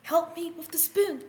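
high pleading kitten call; high kitten plea; kitten crying for help. Acted. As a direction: squeak it out like a small desperate kitten.

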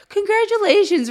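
high, loud, singsong congratulations; retold bright congratulations. Tech telling stunned parents of twins, high singsong cheer. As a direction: beam it out like a cheery nurse.

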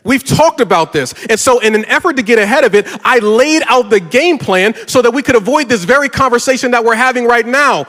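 usual speaking voice

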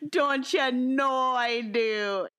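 Minnesota accent. Shown as a demonstration. Co-host capping the casserole joke, exaggerated Minnesota accent. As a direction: stretch it into a hammed-up Minnesota accent. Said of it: "my Minnesota accent".

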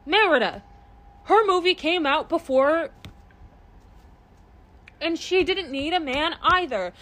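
loud, high, fired-up insistence; heated rant. Real, not acted. Fan ranting to a friend that Merida came first, loud and high. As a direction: push it out loud in an indignant rant.

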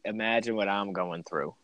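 usual speaking voice

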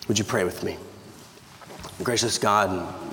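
ordinary delivery

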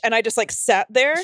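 ordinary talk

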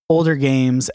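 ordinary talk, nothing marked

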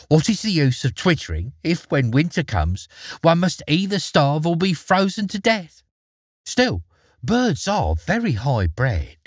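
a lightly voiced field mouse fawning over the mole; fussy field mouse. A narrator's reading. Field mouse's sniffy reply.

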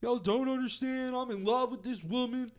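high, far put-on voice of a smitten manager pleading; lovesick Colonel. Manager to his wrestlers, pleading he's in love, high lovesick voice. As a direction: moon over the woman in a high whiny put-on voice. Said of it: "And he's like".

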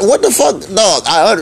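ordinary talk

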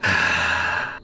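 long tired out-breath; weary sigh. Real, not acted. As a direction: let out a heavy real sigh. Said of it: "That's me sighing".

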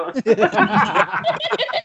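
high-pitched burst of laughter; real laughter. Real, not acted. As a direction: crack up at your own joke. Laughing at Suneo joke.

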